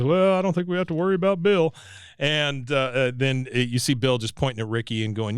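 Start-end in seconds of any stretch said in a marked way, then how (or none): 0.0-1.7 dry Hank. Retold or imitated. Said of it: "Hank just says".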